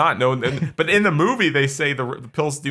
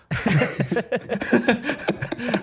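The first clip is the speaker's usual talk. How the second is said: high laugh fit; 11 semitones higher than usual. Real, not acted.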